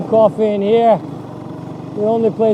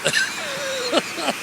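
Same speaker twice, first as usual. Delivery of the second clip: high giggle; 11 dB quieter than usual. Real, not acted. Giggle after Whoops.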